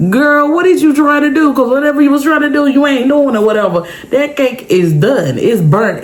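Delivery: a hint of a dad scolding his daughter, amused; dad walks into the smoky kitchen, mock scolding; teasing dad. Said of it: "my dad come in the kitchen and be like".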